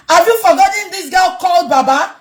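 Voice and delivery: ordinary talk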